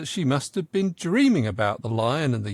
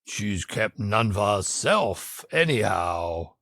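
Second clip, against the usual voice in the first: much lower, slow lion voice; the lion answering; 5 semitones lower, slower than usual. A narrator's reading. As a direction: rumble it slowly like the lion. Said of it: "said the lion".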